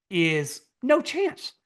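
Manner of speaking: slow, high "is no chance"; scoffing, slowed "no chance"; drawn-out dismissal. Real, not acted. Dismissing Jordan Love odds.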